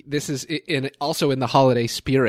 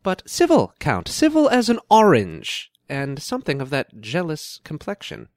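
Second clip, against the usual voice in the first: higher-pitched theatrical reading, settling at the end; Shakespearean lady; 6 semitones higher than usual. Acted. Explaining the sullen Count to the prince, theatrical. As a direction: declaim it with lilting stage wit. Performs Beatrice in scene excerpt.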